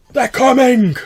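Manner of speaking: high, loud cry of alarm; frantic warning shout. Acted. Stranger warning the gate guards of the attackers, frantic shout. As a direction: yell the warning in panic.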